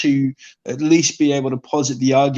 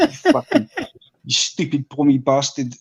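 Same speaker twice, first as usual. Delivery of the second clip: mock South African insult. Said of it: "they say".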